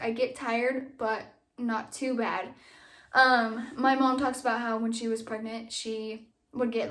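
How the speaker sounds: ordinary talk, nothing marked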